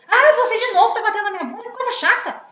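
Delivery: high, loud, fast mock complaint with a laugh; annoyed wife. Wife fed up with husband's slap, high laughing mock complaint. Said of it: "Quando ele faz, puf".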